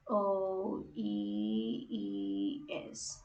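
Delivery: each letter drawn out slowly; name the letters slowly while writing; slow letter naming. Shown as a demonstration. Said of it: "that's how you do yung ating employees".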